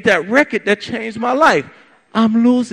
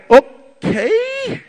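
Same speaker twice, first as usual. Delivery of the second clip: bemused laugh; 7 semitones higher, much slower than usual. Retold or imitated. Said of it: "she's looking at me like".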